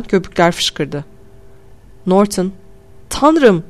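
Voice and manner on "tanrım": higher startled cry; exclamation. Acted. Man startled by a crash in the store. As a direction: gasp out in shock. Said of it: "diye bağırdı".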